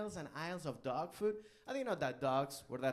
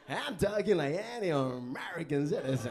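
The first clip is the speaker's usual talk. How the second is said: put-on American accent, a bit louder; fake American accent; 5 dB louder than usual. Insisting to the cop he sounds American, mock American accent. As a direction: keep the stiff American voice going. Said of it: "talking like that".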